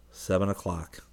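plain reading voice

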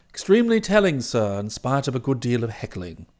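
low, soft, measured valet's report; composed valet Jeeves. Acted. Valet reporting the meeting to his employer, composed and formal. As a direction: report every detail with unruffled formality.

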